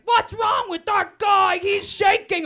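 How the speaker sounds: high, slow, booming imitation shout; loud boyfriend. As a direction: mimic an oblivious loudmouth at full volume. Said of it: "I never realized how loud he was".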